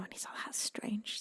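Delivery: hushed, barely voiced telling; whispered spooky storytelling; storyteller whisper. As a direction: drop to a whisper for suspense.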